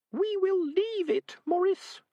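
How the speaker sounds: a tiny rat scolding gently; a rat reproaching her friend, prim and high; prim little rat. Acted. Rat Peaches firm.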